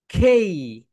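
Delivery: teacher modelling খেই loudly; loud model word. Shown as a demonstration. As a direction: call out খেই for the class to copy. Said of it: "এটাকে বলবো খেই".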